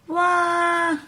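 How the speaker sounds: high, drawn-out cartoon wail; crying wail. A narrator's reading. Storyteller voices George crying, high mock wail. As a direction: imitate a little pig bawling. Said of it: "cries George".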